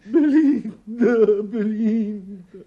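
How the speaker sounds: high, drawn-out cry of a name; distraught calling. Acted. Distraught father calling his daughter Belinda, crying out. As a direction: call out slowly in distress.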